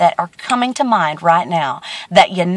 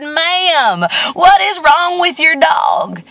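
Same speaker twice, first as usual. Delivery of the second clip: high, slow, emphatic asking; mock-baffled, stretched-out demand; acted exasperated question; 8 semitones higher, slower than usual. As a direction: confront the dog's owner, drawing the words out. Acted baffled passer-by confronts owner.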